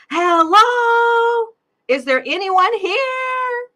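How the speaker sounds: very high, loud, slow scared yell; yelled at the top of her voice. A narrator's reading. Scared brain cell yelling into the empty head, high loud yell. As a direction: scream it out, alone and scared. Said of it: "yelled at the top of her voice".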